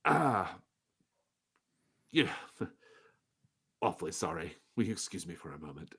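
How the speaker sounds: a touch of nervous shopkeeper excuse; nervous merchant. Acted. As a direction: hurry off with an awkward laugh. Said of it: "he's actually weirdly nervous".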